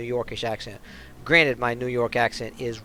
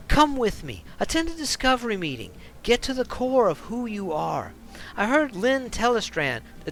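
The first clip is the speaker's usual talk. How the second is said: urgent, worried man begging her to leave; pleading ex; 10 semitones higher than usual. Acted. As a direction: warn her like her life depends on it. Ex voices cult pitch.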